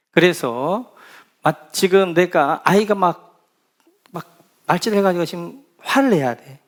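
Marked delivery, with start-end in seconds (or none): none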